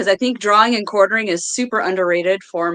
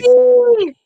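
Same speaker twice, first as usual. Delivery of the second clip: shouting; 7 dB louder than usual. Real, not acted. Sudden shouted outburst.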